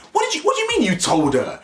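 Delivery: very high, outraged what; mock shocked boyfriend. Voicing the boyfriend shocked at her words, high outrage. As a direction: gasp it in disbelief.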